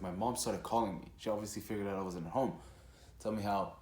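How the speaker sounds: ordinary talk, nothing marked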